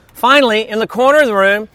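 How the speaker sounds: ordinary delivery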